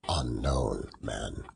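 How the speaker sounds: plain reading voice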